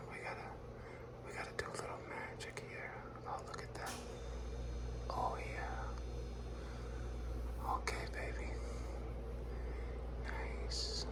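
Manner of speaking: very soft, whispered murmur; hushed little whispers of approval; painting whisper. Real, not acted. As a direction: murmur to yourself while working.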